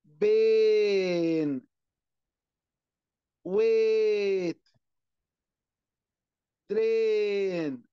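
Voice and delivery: بين، ويت، ترين, each with a drawn-out "ay"; long A vowel. Shown as a demonstration. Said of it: "يبقى بالتالي همد في". (مدّ الإيه في «بين، ويت، ترين»)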